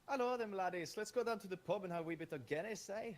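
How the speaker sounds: high, loud, hammed-up Irish accent; cartoon Irishman rallying the lads in a high Irish lilt. Shown as a demonstration. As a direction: put on a squeaky Irish brogue. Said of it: "the Irish talk kind of like".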